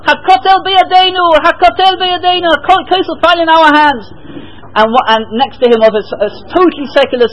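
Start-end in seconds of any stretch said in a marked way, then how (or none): 0.1-4.1 high, overwhelmed exclamation, a hint of tears; retold tearful cry of joy. Soldier at the Kotel overcome with joy, high tearful exclamation. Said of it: "he burst into tears".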